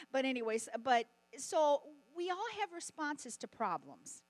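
usual speaking voice

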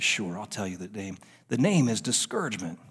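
low, quiet, sly devil. Pretend. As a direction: let the devil purr out the secret. Devil voiced in a story.

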